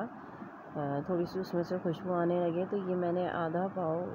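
ordinary talk

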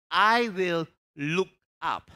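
slow, loud proclamation; hollering revival preacher. Acted. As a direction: lean on each word, loud. Preacher proclaims verse slowly.

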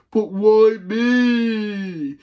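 slow, high, drawn-out whine; whiny protest. Acted. The friend sent for food, whining slowly. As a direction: drag it out like a sulking kid.

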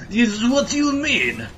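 slow, high mock Russian; Russian accent. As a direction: drawl it in a hammy Russian accent. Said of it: "turn into, you know, Russian".

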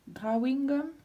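mock English accent on "Drawing gum". Showing her daughter a mock English accent on "Drawing gum". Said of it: "avec l'accent anglaise".

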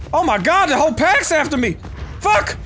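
real panic at being chased; panicked alarm. Real, not acted. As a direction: yelp as the pack closes in. Wolf pack chases him.

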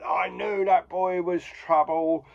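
mock indignant. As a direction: imitate a grumpy teacher proved right. Old Carker's accusation acted out.

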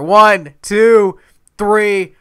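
loud, high three count; shouted pin count. Real, not acted. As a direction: call out each number of the pin.

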